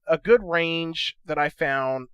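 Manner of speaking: ordinary talk, nothing marked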